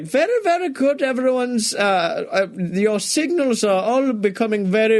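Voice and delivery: high, hammed-up thick German accent; chirpy German professor praising you, German accent; Ludwig von Drake German accent. Cartoon professor praising the rider's brainwaves, mock German accent. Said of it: "the man with the very thick German accent".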